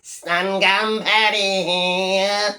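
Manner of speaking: slow, dragging, dull put-on voice of a bored vlogger; mock him by sounding as dreary as possible; sleepy, droning parody greeting; mocking Stun Gun Paddy. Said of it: "Another fucking bore".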